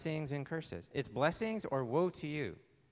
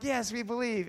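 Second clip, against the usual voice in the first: high, eager agreement; excited crowd reply; 9 semitones higher, 4 dB louder than usual. Acted. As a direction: answer like a fired-up crowd. Excited crowd answers again.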